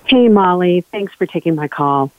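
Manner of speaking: usual speaking voice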